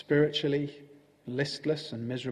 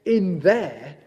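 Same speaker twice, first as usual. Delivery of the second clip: high, drawn-out dismissive Toad voice; slow, exaggerated high-pitched impatience; impatient; 7 semitones higher, 6 dB louder, much slower than usual. A narrator's reading. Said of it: "said Toad impatiently".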